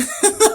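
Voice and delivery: high, loud burst of laughter; short high-pitched cackle. Real, not acted. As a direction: crack up loudly at yourself. Laughing at own southern twang.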